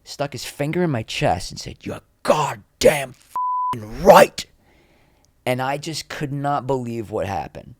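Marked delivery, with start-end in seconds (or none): 1.8-4.5 slow, loud, high retold yell; acted enraged shout, drawn out word by word; in-your-face anger. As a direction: imitate a furious boss, hammering each word. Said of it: "He got right in my face".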